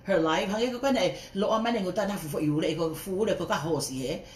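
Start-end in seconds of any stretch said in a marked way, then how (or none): none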